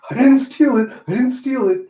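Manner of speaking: put-on accused boy shouting he's innocent; frantic protest. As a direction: yell your innocence twice. Accused character protests loudly.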